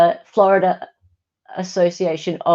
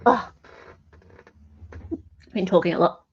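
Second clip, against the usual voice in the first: ordinary talk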